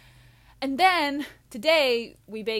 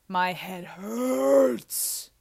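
"hurts" dragged out long and loud; hammed-up drawn-out complaint; exaggerated whine; 6 dB louder, much slower than usual. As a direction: groan the word "hurts", stretching it right out.

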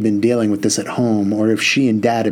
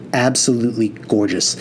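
ordinary delivery

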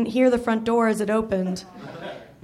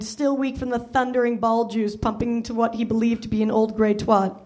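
ordinary delivery

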